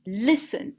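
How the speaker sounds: "listen" with stress on LIS, second syllable light; stress on first syllable. Shown as a demonstration. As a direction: hit LIS in "listen" and let "ten" fall away. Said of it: "C'est accentué sur la première".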